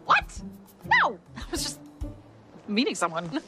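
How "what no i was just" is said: high startled denial; caught off guard. Acted. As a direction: blurt it out in shock. Caught stalking.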